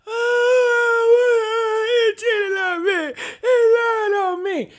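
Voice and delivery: high, whiny fake sobbing; mock crying. Mocking women who act surprised: fake sobbing. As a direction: sob it like a wronged girlfriend, mocking her.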